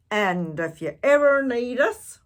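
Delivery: loud hearty offer; pirate captain. A narrator's reading. Braidbeard offering help to the boy, loud hearty. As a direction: speak it like a gruff captain. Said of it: "Braidbeard added".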